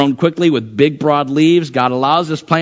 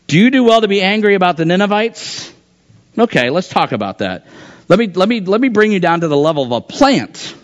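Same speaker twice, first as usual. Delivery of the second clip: normal talking voice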